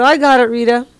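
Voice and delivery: a hammed-up, cheery I got it; mock eager reply. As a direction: toss it off like she just took the glass. Host acting out her line.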